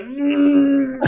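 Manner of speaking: long, high whimpering hum; mock tearful whimper. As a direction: imitate holding back tears with a drawn-out hum. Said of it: "your eyes are like all watery".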